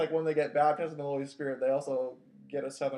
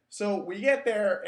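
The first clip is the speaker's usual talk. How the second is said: ordinary talk, nothing marked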